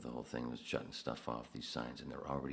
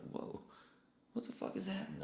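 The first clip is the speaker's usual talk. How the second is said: very high, stunned question; shocked disbelief. Retold or imitated. Confronted by an angry coworker, high stunned disbelief. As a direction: squeak out total confusion.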